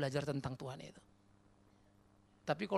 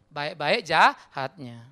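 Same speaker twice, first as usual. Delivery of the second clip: slow ironic punchline; 4 semitones lower, 10 dB louder, much slower than usual. Real, not acted. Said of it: "slow ironic punchline".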